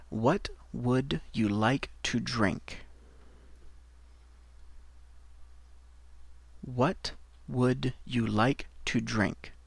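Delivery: slow and soft careful English; slow model phrase. Shown as a demonstration. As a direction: say it slowly for learners. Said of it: "Alors, on va commencer avec".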